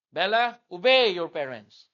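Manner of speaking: firm command. Real, not acted. Commanding the children.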